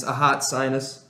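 ordinary talk, nothing marked

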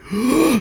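sharp high gasp. Real, not acted. Reliving the Mjolnir moment.

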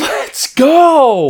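high, excited holler; celebratory shout. Real, not acted. Co-host cheering on the engaged host, high shout. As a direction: yell it out like a cheer.

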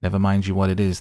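loud, curt retort; sharp retort. A narrator's reading. Guard retorting to the stranger in the mist, loud and curt. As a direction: snap back at the stranger. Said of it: "the guard retorted".

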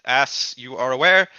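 ordinary talk, nothing marked

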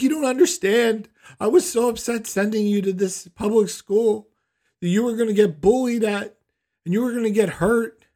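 loud, high mock-tearful wail; imitated hysterical mother. Crying mother explaining her guilt to her son, hysterically. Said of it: "And she's crying".

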